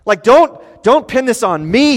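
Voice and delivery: a hammed-up flustered God pleading not guilty; mock flustered protest. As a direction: sputter out a defensive wait wait wait. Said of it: "come back to Habakkuk and go".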